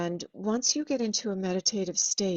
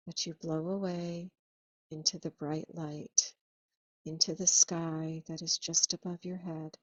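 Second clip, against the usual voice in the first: guided meditation. Acted.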